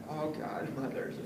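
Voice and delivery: low mock dread at calling mother; dreading groan. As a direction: mutter it low, dreading the call. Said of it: "this is what you sound like".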